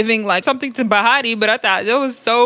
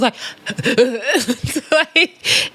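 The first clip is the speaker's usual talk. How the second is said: high giggling fit; real laughter; 4 semitones higher than usual. Real, not acted. Vlogger cracking up over the fight, real laughter. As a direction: laugh helplessly. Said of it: "it was so funny".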